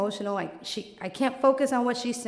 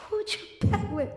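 worried friend; 6 semitones higher than usual. Retold or imitated. Friend voiced.

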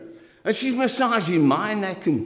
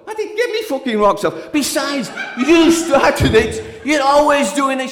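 higher, loud, exasperated yell; angry outburst; 7 semitones higher, 5 dB louder than usual. Retold or imitated. Man yelling at his partner in a fight, angry.

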